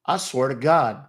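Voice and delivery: usual speaking voice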